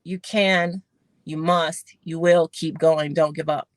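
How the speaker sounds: low, deliberate urging, lightly voiced; inner voice. Little voice in her head urging her on, low and measured. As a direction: lightly play an inner voice, spacing each phrase. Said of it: "this little voice in my head that said".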